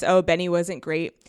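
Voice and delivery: normal talking voice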